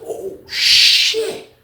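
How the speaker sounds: very high breathy gasp of surprise; whispered shock. Retold or imitated. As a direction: gasp it out, stunned. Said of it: "I was like".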